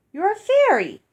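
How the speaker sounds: high, loud excited exclaim. Acted. Child blurting out to Mandy her amazing discovery, excited. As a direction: blurt it out, thrilled.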